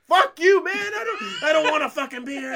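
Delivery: high, very loud shout; hammed-up exploding refusal; mock furious outburst. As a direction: blow up at the pushy drunk. Acted blow-up at Javier.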